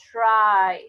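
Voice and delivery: tried said slowly and loud as a teacher's model; model English word. Shown as a demonstration. Teacher modelling "tried" for students, loud and careful. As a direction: pronounce "tried" with a clear final d, like a teacher modelling. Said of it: "el verbo me queda".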